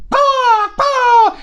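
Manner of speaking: very high loud cawing; delighted crow caw. Acted. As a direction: caw out in triumph.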